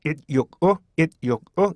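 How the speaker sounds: it육오 in three clipped beats; staccato it육오; choppy syllables. Shown as a demonstration. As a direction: cut it육오 sharply between sounds. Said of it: "따따따 끊어가지고요".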